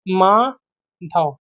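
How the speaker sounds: Madhav drawn out very slowly, syllable by syllable; teacher dictating Madhav slowly; slow spelling-out. Shown as a demonstration. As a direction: stretch Madhav out slowly for the class. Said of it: "spelling देखो मैंने गलत लिख दी".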